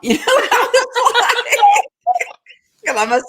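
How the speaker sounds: high squealing laugh breaks through; laughing hard, slowly. Real, not acted. Laughing while retelling phone story.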